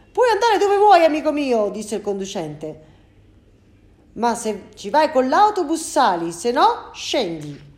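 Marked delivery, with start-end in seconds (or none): 0.1-1.7 very high and loud, fed up; angry bus driver. Acted. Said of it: "Il conducente era tutto arrabbiato". 4.1-7.7 loud and higher, slowing at the end; angry bus driver. Acted. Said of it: "Il conducente era tutto arrabbiato".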